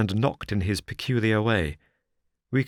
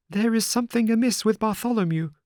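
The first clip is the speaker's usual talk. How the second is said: much higher, tense nervous man's voice; frightened cry; 13 semitones higher than usual. A narrator's reading. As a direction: cry out in alarm as Sholto. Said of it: "he cried".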